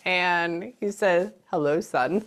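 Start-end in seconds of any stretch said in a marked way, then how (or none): 1.5-2.2 lightly voiced dad greeting, slightly lower and softer; a hint of a fatherly voice. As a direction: imitate a dad greeting his son. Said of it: "like that".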